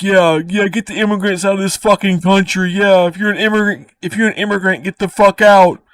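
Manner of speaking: high, hammed-up mock of a gloating bigot; mocking a bigoted friend. Mocking a friend cheering Trump's anti-immigrant win, high mock voice. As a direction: raise your pitch and sneer it out like a caricature.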